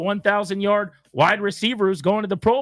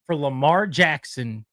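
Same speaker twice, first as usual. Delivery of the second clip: announcer call; 8 semitones lower, slower than usual. Pretend. Host crowning Lamar Jackson MVP, mock announcer boom.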